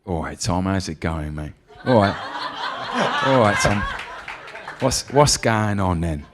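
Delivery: laid-back London mate impression; Idris Elba. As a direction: greet a pal in a London accent, half laughing. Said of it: "Can you do Idris?".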